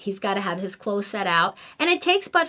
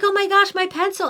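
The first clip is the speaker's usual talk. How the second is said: flustered and rushing, imitating her son; panicky kid; 8 semitones higher than usual. Son panicking, running late.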